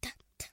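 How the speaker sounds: "T" as a whispered voiceless t tap; letter sound T. Shown as a demonstration. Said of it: "elle fait toujours T". (son T chuchoté)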